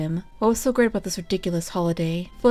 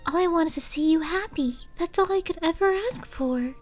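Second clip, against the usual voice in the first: timid mare assuring Discord she only wants him happy; gentle sincere; 9 semitones higher than usual. Acted. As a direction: tell him tenderly, half whispering. Fluttershy sincerely declines gift.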